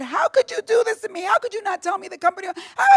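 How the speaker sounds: a wounded plea, voice climbing high; young manager confronting her boss, high and hurt; tearful plea. Retold or imitated. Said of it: "I said".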